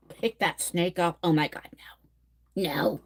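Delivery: whispered, aghast; real whispered horror; horrified whisper. Real, not acted. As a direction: breathe it out in disbelief. Horrified at a snake handling.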